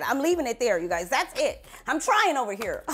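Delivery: normal talking voice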